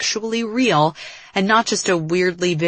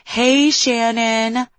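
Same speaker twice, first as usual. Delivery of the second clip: higher, drawn-out mimicry; mocking quote; 6 semitones higher, 4 dB louder, much slower than usual. A narrator's reading. Exasperated friend ends a complaint by quoting Liv, mocking quote. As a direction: parrot the greeting back, stretched out. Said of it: "all you can say is".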